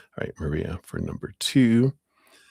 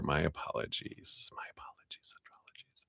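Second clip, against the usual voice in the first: apology fading into near-silent whisper; 4 semitones lower, 13 dB quieter than usual. Real, not acted. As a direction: trail off under your breath, apologising. Apologising to herself, fading away.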